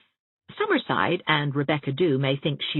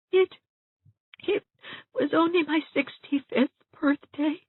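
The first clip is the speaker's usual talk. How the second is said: put-on high, hesitant, teary old lady; halting, much higher and wounded; tearful Aunt Shatty; 13 semitones higher than usual. A narrator's reading. Aunt Shatty tearfully reminds Kate of her birthday.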